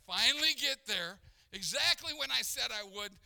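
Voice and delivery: loud, high aggrieved retelling; exasperated. Retold or imitated. Preacher recounting the long hot drive, exasperated. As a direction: hit it hard with indignation. Retold grievance at wasted drive.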